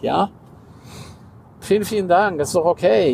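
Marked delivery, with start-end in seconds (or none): 1.6-3.1 higher, bright imitated gratitude; lightly voiced upbeat customer; a hint of sing-song thanks; mock cheerful thanks. Voicing the ideal positive reply.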